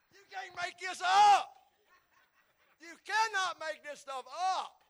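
loud, high, slow disbelief; amused exclamation. Real, not acted. Preacher marvelling at the verse to congregation, loud amusement. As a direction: belt it out in delighted amazement.

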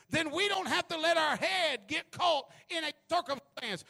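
loud, high fiery preaching; preaching at full force. Acted.

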